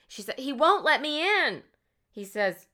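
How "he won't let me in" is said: distraught social worker. Retold or imitated. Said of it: "she's very, like, distraught".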